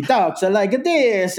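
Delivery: slow, high cartoon Italian accent; hammed-up Italian accent, part plumber, part vampire; mock Italian accent. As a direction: stretch it out like a Mario-Dracula Italian. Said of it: "Italian accent that I would cr".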